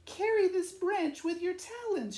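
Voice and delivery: high, light swallow voice; Lolita's voice. Acted. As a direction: pitch it up like a small bird. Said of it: "she said".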